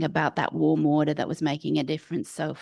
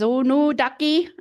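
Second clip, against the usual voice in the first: high, loud attempt at a Scottish accent; 6 semitones higher, 5 dB louder than usual. Old midwife answers the trainee's question, Scottish accent. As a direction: try a Scottish midwife's voice, pitched up. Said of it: "try and do an, a Scottish accent".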